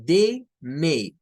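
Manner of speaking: "They may" with an ei glide in both words; English "They may". Shown as a demonstration. Teacher modelling "They may" for the class. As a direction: slide each vowel from e to i. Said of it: "el sonido es A".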